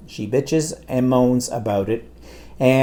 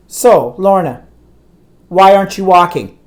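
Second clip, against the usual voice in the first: raised, sharply demanding doctor; a louder, pointed imitation of the doctor's question; stern doctor; 5 semitones higher, 7 dB louder than usual. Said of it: "That's how she said it".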